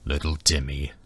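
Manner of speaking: deep, low dragon voice; low-pitched, rumbling beast; booming dragon. A narrator's reading. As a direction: echo it back as a great dragon. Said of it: "the dragon boomed back".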